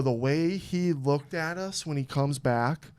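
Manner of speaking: normal talking voice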